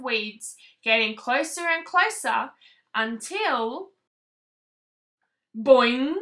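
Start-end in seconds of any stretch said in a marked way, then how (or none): none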